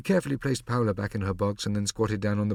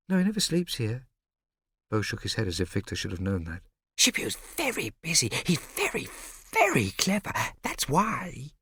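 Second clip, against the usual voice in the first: put-on much higher child, matter-of-fact; a small boy proud of his hero; high little boy voice; 5 semitones higher than usual. A narrator's reading. Narrator voicing little boy Bo.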